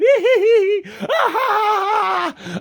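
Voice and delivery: high mocking cackle of a giggle; Kamala Harris giggle. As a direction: burst into a shrill mock giggle to ridicule. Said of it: "giggling and laughing like fucking Kamala Harris".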